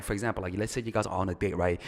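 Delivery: ordinary talk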